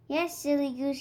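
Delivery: ordinary delivery, nothing marked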